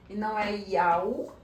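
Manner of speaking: "Yau" said as a wrong, loud form. Shown as a demonstration. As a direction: say "Yau" loudly as the mistake. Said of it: "Ó o que que o povo faz". (pronúncia errada "Yau" em voz alta)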